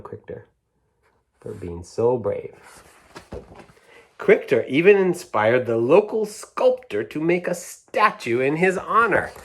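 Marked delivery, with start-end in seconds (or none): 4.2-9.3 higher, louder storybook narration; lively read-aloud; reading to children. A narrator's reading. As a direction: celebrate the hero for a child. Reads picture book aloud.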